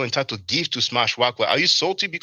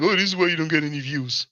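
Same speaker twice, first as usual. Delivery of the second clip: mocking; 2 semitones higher than usual. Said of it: "don't be acting like".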